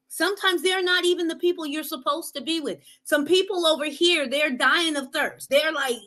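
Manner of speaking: normal talking voice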